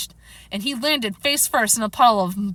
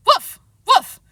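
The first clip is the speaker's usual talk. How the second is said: high, loud spoken "woof"; two short, high woofs; dog bark; 12 semitones higher, 8 dB louder than usual. Acted. Dog narrator barks on command.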